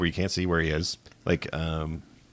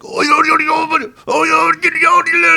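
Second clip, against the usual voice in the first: much higher, squeaky doll gibberish; Chucky in the Spanish dub; 7 dB louder than usual. Retold or imitated. Imitating Chucky's Spanish dub for a friend: squeaky gibberish. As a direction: chatter like a tiny frantic doll. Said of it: "in Spanish, he's like".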